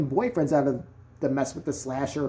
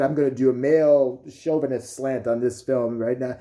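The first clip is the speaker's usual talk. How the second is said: lower-pitched guy announcing his film; mock macho director, pitched down; mock male chauvinist filmmaker; 5 semitones lower than usual. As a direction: put on a chauvinist man's voice. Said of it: "That'd be like if a guy said".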